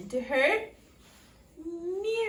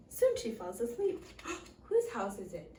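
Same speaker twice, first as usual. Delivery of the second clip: plain reading voice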